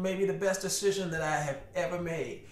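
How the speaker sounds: usual speaking voice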